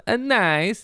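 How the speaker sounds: high-pitched mock Kazakh accent; playful, high Borat-style accent. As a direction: exclaim it in an imitation Borat accent. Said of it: "Sacha Baron Cohen is awesome".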